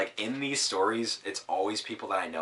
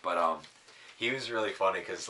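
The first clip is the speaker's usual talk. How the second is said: ordinary delivery, nothing marked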